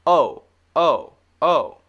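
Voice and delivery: "O" as a round "oh", loud and isolated; long O sound. Shown as a demonstration. Teacher recalling the long O for learners, loud round "O". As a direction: punch out each round "O". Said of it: "when we did the long O sound".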